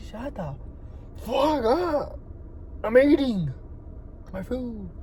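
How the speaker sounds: exasperated retort at reduced volume; mock exasperation. Acts out second retort to the critic.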